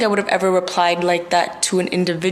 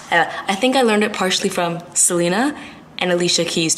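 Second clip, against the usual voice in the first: normal talking voice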